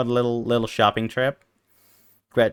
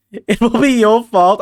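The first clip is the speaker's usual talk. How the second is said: mock outburst; 9 semitones higher, 5 dB louder than usual. Sudden high outburst.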